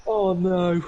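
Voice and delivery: hammed-up dismay, pitched high; heartbroken manager. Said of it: "I see the man going".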